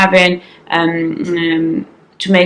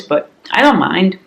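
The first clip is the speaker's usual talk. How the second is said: ordinary talk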